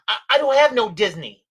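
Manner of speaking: panicked client. Retold or imitated. Old client alarmed by adviser's note, panicked protest. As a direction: stammer into a loud, panicky mock objection.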